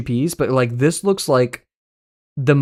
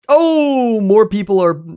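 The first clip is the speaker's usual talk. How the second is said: much higher, louder excited oh; excited exclamation; 8 semitones higher, 6 dB louder, much slower than usual. Real, not acted. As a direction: jump up into a delighted exclamation. Reacting to a vote matchup.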